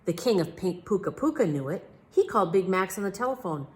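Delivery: plain reading voice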